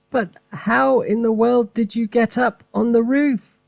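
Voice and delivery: high, astonished mother; mother crying out. Acted. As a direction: cry up to the roof in disbelief. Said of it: "cried the mother".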